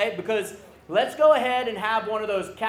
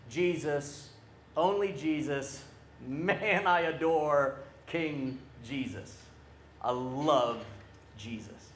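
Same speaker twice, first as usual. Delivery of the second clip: soft, low, reverent devotion; hushed adoration; 5 semitones lower, 5 dB quieter than usual. Real, not acted. Preacher confesses love for King Jesus, hushed adoration. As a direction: drop low and let it sink softly.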